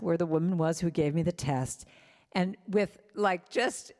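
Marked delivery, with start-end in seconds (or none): none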